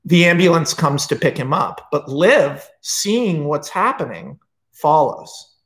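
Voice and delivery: normal talking voice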